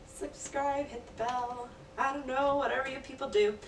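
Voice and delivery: playful. Real, not acted. Tossed-off outro calls.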